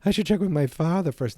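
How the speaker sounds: high, loud put-on kid voice; imitated Marty Junior. Film fan imitates the bullied kid making excuses, high-pitched. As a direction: push the pitch up like a flustered boy. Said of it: "because he's like".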